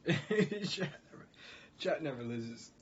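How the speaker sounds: high, slow words broken by laughter; laughing. Real, not acted. Streamer concedes that chat never loses, laughing. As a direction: crack up halfway through the phrase. Cracks up again over chat's joke.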